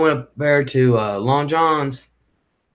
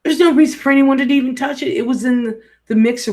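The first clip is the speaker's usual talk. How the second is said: higher, louder and fast, exasperated; exasperated rant; 11 semitones higher, 4 dB louder, faster than usual. Real, not acted. Venting to viewers about the missing whisk, exasperated and fast. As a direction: vent your disbelief at the family.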